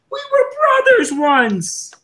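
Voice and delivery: high, slow dramatic line; dramatic quote. Acted. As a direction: wail the line out slowly. Quoting Nuju vs Karzani.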